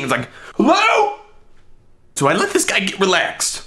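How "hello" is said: very high questioning "Hello"; imagined bewildered neighbour. Retold or imitated. Baffled guy to the hidden stall occupant, mock high call. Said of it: "what this guy's thinking".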